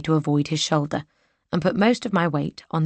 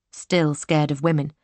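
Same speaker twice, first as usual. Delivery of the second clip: ordinary delivery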